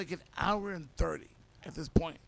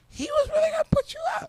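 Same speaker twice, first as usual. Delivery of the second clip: mocking friends; 6 dB louder, faster than usual. Imitating friends' reaction.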